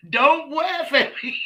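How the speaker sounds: put-on high little-kid protest with "laugh" as "waff"; imitating a toddler while laughing; can't say L. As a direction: pitch it up, W for the L in "laugh", cracking up. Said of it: "And he would say".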